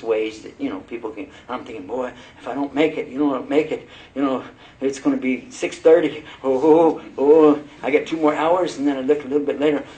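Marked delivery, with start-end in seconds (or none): 1.9-6.2 worried inner voice. Retold or imitated. Retells his first fast anxiety. 6.4-8.8 mock panic. Retold panic, counting hours.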